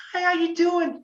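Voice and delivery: high, sweet hello; sweet greeting. Retold or imitated. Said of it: "Sweet. Couldn't be sweeter".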